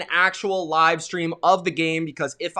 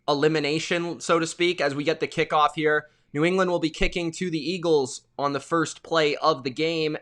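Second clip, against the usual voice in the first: ordinary talk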